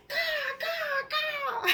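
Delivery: very high, loud cawing; squawking crow imitation across the yard. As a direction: caw like a crow while chasing it. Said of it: "I'm going".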